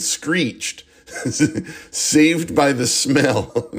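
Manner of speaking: slow, laughing through the title; can't keep a straight face reading it, drawn out. Real, not acted. Laughing at a funny porn title.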